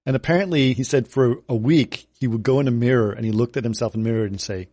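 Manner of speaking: ordinary talk, nothing marked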